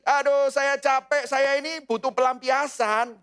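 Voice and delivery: hammed-up exhausted grumbling; whiny complaint. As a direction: drag it out like someone sorry for themselves. Tired sinner wanting release.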